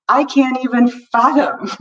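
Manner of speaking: ordinary talk, nothing marked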